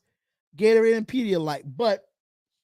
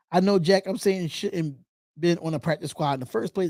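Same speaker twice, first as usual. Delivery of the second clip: ordinary talk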